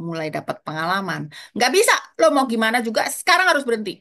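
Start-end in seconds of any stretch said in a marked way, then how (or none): 1.5-3.9 quick, raised-pitch insistence; retold firm refusal. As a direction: put your foot down, louder and brisker. Voices a firm refusal to child.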